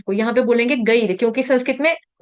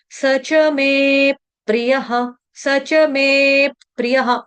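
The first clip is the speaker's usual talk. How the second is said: में pushed up with a rise before प्रियः; chanted with weight on में; stress on में; much slower than usual. Shown as a demonstration. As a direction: lean on में, then chant प्रियः slowly. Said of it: "में के ऊपर आघात आएगा".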